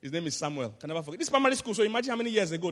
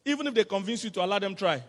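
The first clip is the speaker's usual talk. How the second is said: ordinary talk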